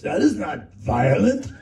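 slow, loud German-accented imitation of the director; loud mock German accent. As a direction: put on a heavy German accent, slowly. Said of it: "deep German accent".

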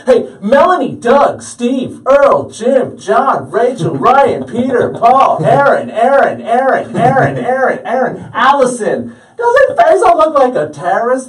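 high, loud roll-call holler; summoning friends. Retold or imitated. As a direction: shout out each name to gather the gang. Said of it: "he summoned the rest of our friends".

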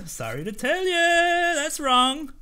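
high, slow teasing sorry to tell ya; mock sing-song. Guard taunting the traveller while refusing, sing-song. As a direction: stretch it up in a mocking lilt.

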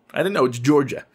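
usual speaking voice

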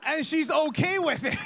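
high, loud, incredulous punchline; comic disbelief. Acted. Comic outrage at lookalike woman's acceptance.

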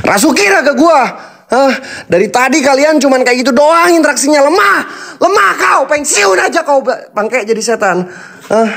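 high, mocking taunts flung at the spirits; taunting challenge. Real, not acted. Ghost hunter taunting the spirits in the hotel, high jeering challenge. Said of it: "menantang kalian dengan lantang".

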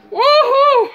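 high whooping cry; excited shout. Real, not acted. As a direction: yelp it out high and loud. Reacting to cayenne pepper burn.

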